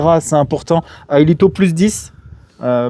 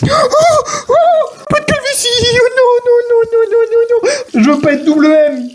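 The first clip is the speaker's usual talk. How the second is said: mock wail; 3 dB louder than usual. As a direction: wail about the hair loss. Wailing over hair loss. (lamentation aiguë exagérée)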